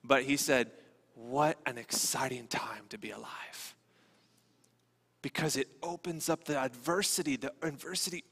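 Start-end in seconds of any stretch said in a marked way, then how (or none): 1.1-3.8 soft, slow, half-whispered quote; hushed awe. Retold or imitated. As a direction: say it hushed and slowly, savoring it. Missionary's marvel quoted in hushed voice.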